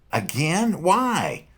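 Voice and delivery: very high indignant cry; shocked whine. Acted. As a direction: cry out in disbelief. Punk slapped again.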